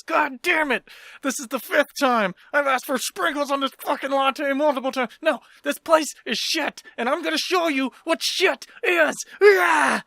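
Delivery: high, strained mock tantrum building to a yell; hammed-up fury, ending in a shout; enraged customer meltdown. Acts out the furious customer's meltdown.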